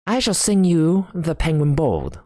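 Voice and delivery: high-pitched showman's introduction; lofty, falling flourish; grand announcement. Acted. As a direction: announce your party piece proudly. Sam grandly announces his song.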